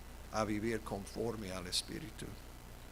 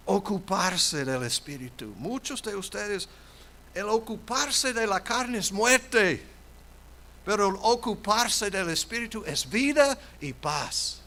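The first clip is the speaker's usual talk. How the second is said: loud, higher preaching cry; full-force preaching; 8 semitones higher, 8 dB louder than usual. Acted. Pastor proclaiming to the congregation, loud preaching. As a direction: preach it out to the whole church.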